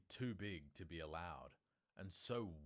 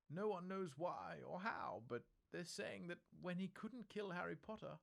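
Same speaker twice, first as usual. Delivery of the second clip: put-on high, tremulous old witch; trembling voice; 13 semitones higher, 3 dB louder than usual. A narrator's reading. McGonagall tells Dumbledore the rumours, trembling and high. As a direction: McGonagall fighting back her shock. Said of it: "Professor McGonagall's voice trembled".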